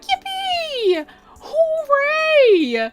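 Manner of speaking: mock a kid gleefully hatching eggs; imitating Jaden's cheer. Retelling Jaden hatching a shiny Bidoof, squeaky high cheer. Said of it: "Like, like that".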